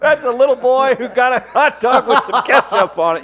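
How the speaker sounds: loud, high, laughing outburst; laughing mockery. Real, not acted. Host teasing Travis over his photo, laughing. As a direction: crack up while teasing loudly.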